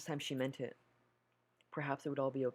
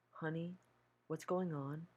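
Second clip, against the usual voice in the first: higher, coaxing mother through the door; sweet mother's voice; 4 semitones higher, slower than usual. Acted.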